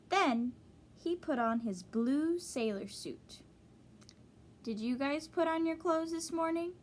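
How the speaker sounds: ordinary delivery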